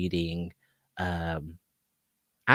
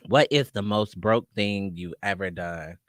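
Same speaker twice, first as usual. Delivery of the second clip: ordinary delivery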